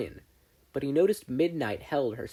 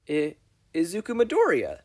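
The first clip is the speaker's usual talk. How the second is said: put-on higher boy voice, stumbling start; nervous stammer; 6 semitones higher, 5 dB louder than usual. Izuku shyly giving his name to Mei, stammering. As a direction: trip over your own name.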